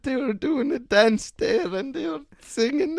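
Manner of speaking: high, helpless laughter near tears; can barely get words out for laughing; cracking up, tearful with laughter; laughing till crying. Real, not acted.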